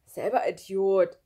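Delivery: loud, slow grumbled comeback; scolding retort. A narrator's reading. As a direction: throw the insult back loudly, drawn out. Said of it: "schimpfte Christoph". (laut zurückgeschimpft)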